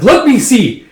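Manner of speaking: loud, high insistence; urgent demand. Acted. Acted urgent demand to see result.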